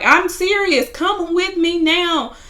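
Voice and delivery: high, insistent retold plea; imitating a pleading girlfriend. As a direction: play the desperate girlfriend begging him to leave. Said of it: "she was like".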